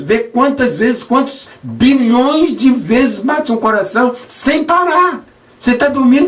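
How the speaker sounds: high, rising preacher's chant, building; fervent preaching. Acted. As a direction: preach it with growing fire, repeating the beat. Preacher builds heartbeat litany. (pregação inflamada, voz alta e crescente)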